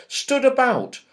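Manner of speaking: ordinary delivery, nothing marked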